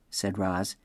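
ordinary delivery, nothing marked

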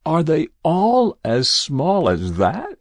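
farmer's strained, dismayed question; hoarse question. A narrator's reading. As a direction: ask it haltingly, horrified by what you saw. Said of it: "he asked hoarsely".

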